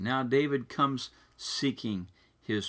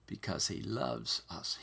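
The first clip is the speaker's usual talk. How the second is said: hushed tender aside; 6 semitones lower, 9 dB quieter than usual. Real, not acted. Pastor softly telling the congregation, hushed and tender. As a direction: drop to a near-whisper, softer and intimate.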